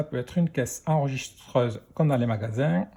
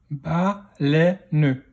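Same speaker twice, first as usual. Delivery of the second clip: "baleine" split into ba, lè, ne; "baleine" in three separate beats; syllable by syllable. Shown as a demonstration. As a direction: sound out "baleine" with a final ne. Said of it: "dans quelle syllabe".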